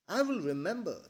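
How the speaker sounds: put-on teasing copy of the girl's tone; mimicking her tone. A narrator's reading. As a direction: parrot her words back playfully. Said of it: "mimicking her tone".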